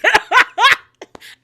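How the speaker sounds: loud, high burst of cackling; loud cackle. Real, not acted. Host losing it at drunk Quad, loud cackle.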